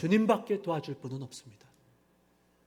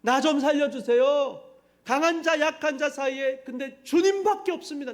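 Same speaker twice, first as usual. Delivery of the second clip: much higher and louder, a desperate plea; crying out in prayer; 5 semitones higher, 4 dB louder than usual. Acted. Preacher acting out king Asa's desperate loud prayer for help. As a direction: beg loudly like a king facing an army. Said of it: "부르짖어 기도합니다".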